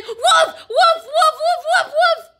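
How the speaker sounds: high, loud breathless calls of wolf; repeated frantic cries. Acted. Boy runs to town, high loud repeated cries. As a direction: keep calling it all the way to town. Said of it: "He ran back to the town crying".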